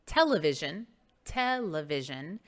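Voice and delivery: television with the stress on TEL; stress on first syllable. Shown as a demonstration. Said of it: "It's the reason we say".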